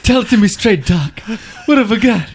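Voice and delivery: high, loud pleading patient; hammed-up dread, voice pushed high and loud; mock-desperate patient. Joking patient begs the doctor for a diagnosis, mock-desperate.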